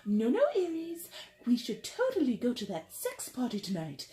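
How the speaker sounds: high British granny voice; playful imitation of a little British grandma; Missus Butterworth. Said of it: "why Missus Butterworth became British".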